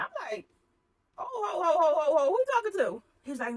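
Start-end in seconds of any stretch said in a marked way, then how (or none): 1.2-3.0 high, loud, indignant "oh ho ho"; retold indignant clapback, much higher and louder; offended comeback. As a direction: push back hard on the boss, sassy. Offended reply to boss's demand.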